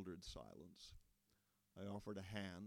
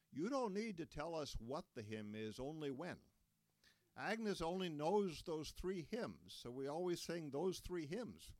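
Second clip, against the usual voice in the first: higher voiced old farmer, slowing on the three hymns; lightly raised old man's voice, explaining; Lloyd's voice; 8 semitones higher, 6 dB louder than usual. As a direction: play the apologetic church elder.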